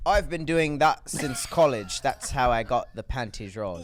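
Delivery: slow, choppy put-on British accent; a mildly robotic attempt at a British accent; forced British accent. Friend repeats the line trying out a British accent. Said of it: "You sound like you're forcing it".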